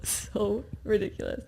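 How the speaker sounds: cracking up mid-sentence; laughing. Real, not acted. Wife laughs at how ridiculous the story gets, giggly. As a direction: try to talk through the giggles.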